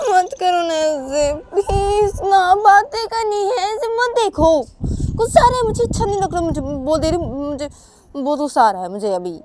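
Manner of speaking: upset Uma. Acted. Uma telling Sanju she is getting angry, wailing.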